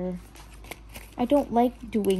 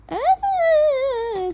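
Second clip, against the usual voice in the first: long high-pitched wail, louder; dismayed squeal; 5 dB louder than usual. Real, not acted. Thinks the fix failed, high dismayed squeal. Dismay at botched sticker placement.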